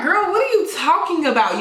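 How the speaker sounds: high, acted-out disbelief; incredulous. Girlfriend questioning his sister's warning, high incredulous voice. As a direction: pitch it up like you can't believe her.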